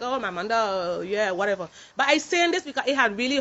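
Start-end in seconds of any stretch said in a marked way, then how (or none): 0.0-1.7 low, slow, bored brush-off; a lightly voiced dismissive viewer, low and drawn out; mock dismissive shrug. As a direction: play it like you couldn't care less. Imagined dismissive reaction to video.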